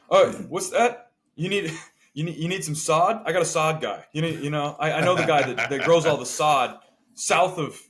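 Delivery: mock a pal boasting of an obscure contact; Alex. Friend offering his sod guy unasked, eager mock name-dropping. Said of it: "Alex does weird name dropping".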